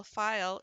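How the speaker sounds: "file" drawn out as fi-yul; two syllables. Shown as a demonstration. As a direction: stretch "file" slowly into fi-yul, like a child spelling it. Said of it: "they really do say it as two syllables".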